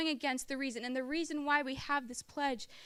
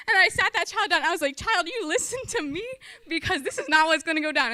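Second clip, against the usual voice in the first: louder, higher no-nonsense telling-off; firm mock-scold, raised voice; stern scolding; 4 semitones higher, 9 dB louder than usual. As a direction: lay down the law to a seven-year-old. Scolding the child firmly.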